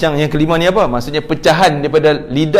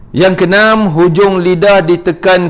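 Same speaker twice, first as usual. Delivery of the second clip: louder, slow, last word long drawn out; emphatic announcement; 3 semitones higher, 5 dB louder, slower than usual. Real, not acted. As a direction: stretch the final word like a recited rule. Teacher announces next articulation point. (umumkan dengan lantang dan meleret)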